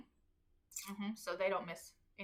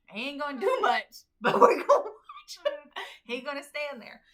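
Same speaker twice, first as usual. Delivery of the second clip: laughing defiance; 4 semitones higher, 9 dB louder than usual. Retold or imitated.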